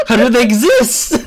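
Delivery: high-pitched laughing disbelief. Real, not acted. Gamer cracking up at the ghost joke, laughing. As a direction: squeak it out through real laughter.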